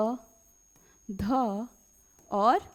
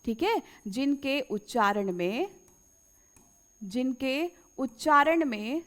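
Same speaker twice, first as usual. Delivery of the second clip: slow, word by word for copying; measured dictation pace; slower than usual. Shown as a demonstration. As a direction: say it slowly so they can write it down. Dictating definition slowly to students.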